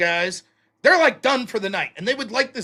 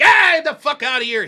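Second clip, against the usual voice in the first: louder, higher mock yell; heckling yell; 4 semitones higher, 6 dB louder than usual. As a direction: heckle them like an annoyed drunk. Acting out heckling the hippies.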